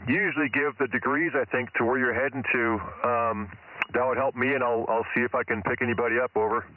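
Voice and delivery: usual speaking voice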